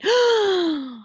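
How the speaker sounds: put-on sharp, high, loud kid gasp; shocked gasp. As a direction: gasp like a startled child. Child spots someone smoking.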